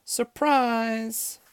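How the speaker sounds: very high, held-out cheer; high-pitched celebratory call; group surprise shout. A narrator's reading. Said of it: "all the ladies shouted".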